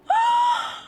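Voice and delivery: high excited gasp; sharp gasp. Real, not acted. Streamer gasping at the letter line. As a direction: gasp in delighted shock.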